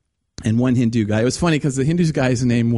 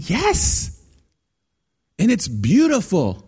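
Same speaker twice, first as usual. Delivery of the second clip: excited yes; 5 semitones higher, slower than usual. Real, not acted. Preacher answering his own question.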